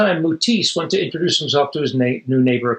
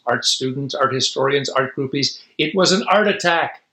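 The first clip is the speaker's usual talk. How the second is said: ordinary delivery, nothing marked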